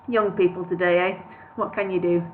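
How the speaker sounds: ordinary delivery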